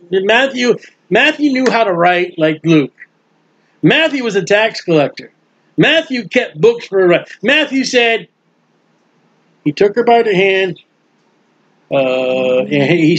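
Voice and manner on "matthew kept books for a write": normal talking voice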